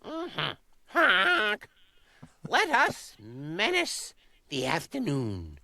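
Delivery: high, excited goose voice; a dim, eager goose. Acted. Goose character.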